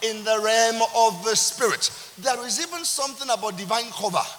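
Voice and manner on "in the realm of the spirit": fiery preaching. Acted. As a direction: preach it out to the congregation. Preaching at full force.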